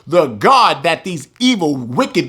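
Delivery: ordinary delivery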